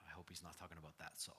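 hushed, fast, worried whisper; nervous whisper. Retold or imitated. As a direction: whisper it like Ananias panicking. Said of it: "freaks out a little bit".

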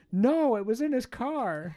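put-on very high, slow naive girl; high girly voice. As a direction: correct her innocently in a tiny voice. Cashier voiced in retold story.

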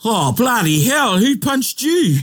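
high, slow, stretched-out shock; shocked exclamation. Acted. Acting out the kiwaha scene.

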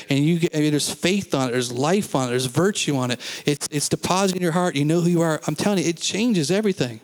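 ordinary talk

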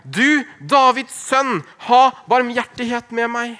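high, loud, slow plea. A narrator's reading. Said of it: "ropte bare enda høyere".

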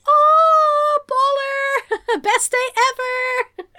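high, loud, gleeful whoop; overjoyed villain cheering; mock delight. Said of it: "he's just like".

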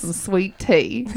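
slow, playful Southern drawl; Southern accent. Pretend. Host jokes about Christmas wishes in a Southern accent. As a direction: draw it out in a put-on Southern accent. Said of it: "in my Southern accent".

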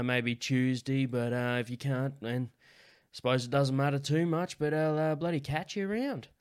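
slow rural Aussie drawl, lightly done; laconic outback drawl. Retold or imitated. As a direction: imitate a laid-back country farmer. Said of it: "Getting ready for the impression".

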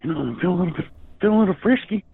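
high squeaky Bubbles impression. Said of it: "You sound like a fucking Bubbles".

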